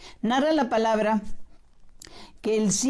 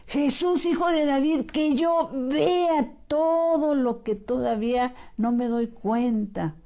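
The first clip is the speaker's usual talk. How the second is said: heartfelt cry; 6 semitones higher than usual. Shown as a demonstration. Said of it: "gritarle, pero con el corazón".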